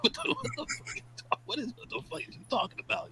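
high, fast squeal of disbelief; squeaky outrage at a cartoon villain's plan; mock outrage. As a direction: shriek at the dumb plan in mock shock.

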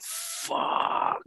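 hushed, voiceless mock swear; toddler mode. Retelling regressing after being caught, whispered childish swear. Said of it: "toddler mode, mate".

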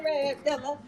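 ordinary delivery

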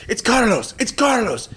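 high-pitched, loud mimicked fan shout; imitated excited yell. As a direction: yell it out like a fan spotting a star. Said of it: "somebody just yells".

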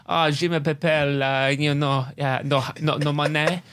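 halting, panicked mock French; talking French. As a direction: stumble through bad French, starting slowly. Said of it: "I just started talking French".

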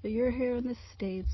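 normal talking voice

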